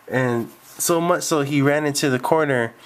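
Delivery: ordinary delivery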